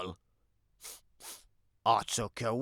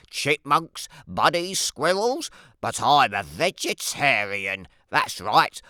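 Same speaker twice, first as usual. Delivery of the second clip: usual speaking voice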